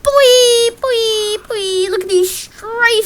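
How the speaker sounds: high, loud goofy whooping; over-the-top squeal of mock excitement; carried away. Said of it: "I'm getting carried away".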